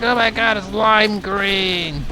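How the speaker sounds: a hammed-up excited scream; mock scream. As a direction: squeal it like she's freaking out. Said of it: "she's screaming".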